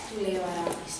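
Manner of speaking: ordinary delivery, nothing marked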